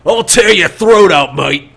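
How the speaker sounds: high, very loud yell in character; full-volume fighter's yell; yelled threat. Acted. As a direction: holler a violent threat from the back. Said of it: "yells out from the back".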